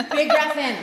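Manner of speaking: loud, high call to breathe in; coaching cue. Shown as a demonstration. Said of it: "So you'll do a big breath in".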